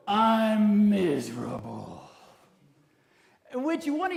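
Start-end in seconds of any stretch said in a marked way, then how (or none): 0.1-2.1 mock misery. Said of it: "they immediately go".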